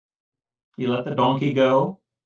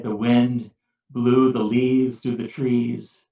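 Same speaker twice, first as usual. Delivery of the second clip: plain reading voice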